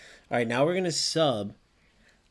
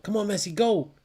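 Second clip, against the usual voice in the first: ordinary talk, nothing marked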